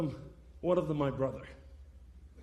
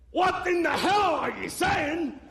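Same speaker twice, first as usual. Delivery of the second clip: high, loud, slow shouted outrage; retold scream; 10 semitones higher, 4 dB louder, slower than usual. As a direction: shout it out in disbelief at him. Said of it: "he starts screaming at me".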